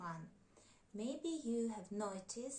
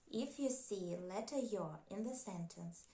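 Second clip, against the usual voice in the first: ordinary talk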